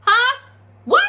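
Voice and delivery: loud, high-pitched taunting demand; mock squaring up; confrontational challenge. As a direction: fire it off like daring him to answer.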